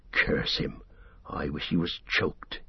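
low, half-whispered grumble under the breath; muttered curse. Acted. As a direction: Sam cursing Gollum quietly so he won't hear. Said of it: "he muttered".

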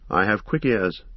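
usual speaking voice

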